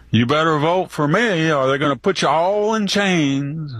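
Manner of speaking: mock Biden quote, understated. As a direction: lightly imitate Biden rallying a crowd. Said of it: "like Biden said".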